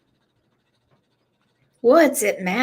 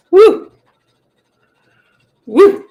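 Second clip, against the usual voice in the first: whoop; 12 semitones higher, 9 dB louder than usual. Real, not acted. Cheering on stream.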